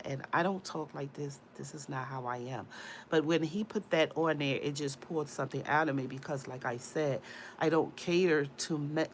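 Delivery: ordinary talk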